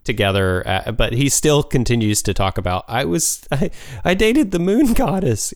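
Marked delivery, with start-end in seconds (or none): 2.9-5.5 put-on high-pitched teenage brag; Sokka. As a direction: lovestruck teen boasting, pitched up. Mimics boastful teen Sokka.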